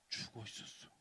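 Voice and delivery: hushed whispered reveal. Acted. Preacher revealing the chick died, whispered. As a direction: drop to a whisper.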